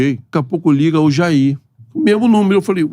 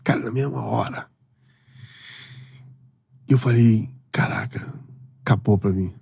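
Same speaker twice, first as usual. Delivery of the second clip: quiet, emotional remembering of father; softly, holding back tears; quiet, choked-up memory of his late father; moved; 8 semitones lower, 7 dB quieter than usual. Real, not acted. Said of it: "veio a imagem do meu pai".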